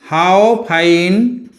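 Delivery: how fine the weather is, word by word with long gaps; teacher dictating the answer; dictation pace. Shown as a demonstration. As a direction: spell it out slowly for writing. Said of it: "in the answer you will have to write".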